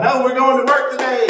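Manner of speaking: rally a sleepy class, loud and high. Retold or imitated. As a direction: call it out like an energetic teacher. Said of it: "And I get louder and louder".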